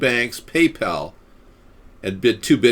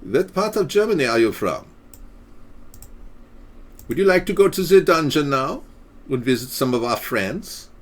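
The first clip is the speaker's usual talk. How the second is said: hammed-up German accent, higher; 5 semitones higher than usual. Streamer reading to chat in a mock German accent, higher. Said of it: "we'll do it in the German accent".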